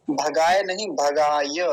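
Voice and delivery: भगाय with the final य said fully and slowly; slow भगाय, stressing the last य; careful भगाय, final ya clear. Shown as a demonstration. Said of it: "यकार को ठीक से बोलेंगे".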